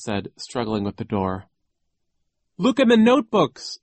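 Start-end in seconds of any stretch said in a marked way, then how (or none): 2.6-3.8 high loud plea; urgent cry. A narrator's reading. Isadora pleading with Klaus from the car, loud cry. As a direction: cry out the instruction fast. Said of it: "Isadora cried".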